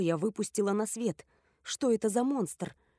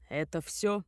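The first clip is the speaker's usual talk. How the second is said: dry; 2 semitones lower than usual. A narrator's reading. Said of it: "сухо уточнил Грегор".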